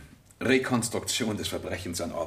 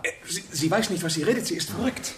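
agitated protest; 9 semitones higher than usual. Acted. Lover protests her false confession.

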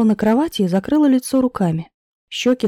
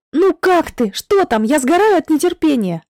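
high, eager, words tumbling out; bursting with impatience; rapid excited chatter; 6 semitones higher, 3 dB louder than usual. A narrator's reading. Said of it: "Сразу затараторила она". (взволнованно тараторит)

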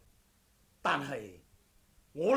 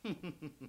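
soft laugh; 2 semitones lower, 11 dB quieter than usual. Acted. Swordsman chuckles softly at his rival's question. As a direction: chuckle quietly to yourself.

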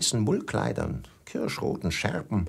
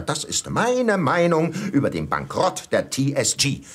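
normal talking voice

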